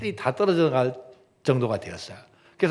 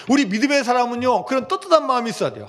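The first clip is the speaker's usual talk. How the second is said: fervent preaching; 8 semitones higher, faster than usual. Real, not acted. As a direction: drive the point home with fire.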